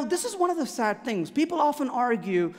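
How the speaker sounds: ordinary talk, nothing marked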